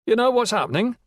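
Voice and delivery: put-on old villager. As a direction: call out from the porch. Old man calls from porch.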